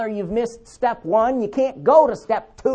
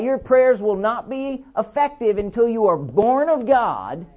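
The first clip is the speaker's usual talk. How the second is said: ordinary talk, nothing marked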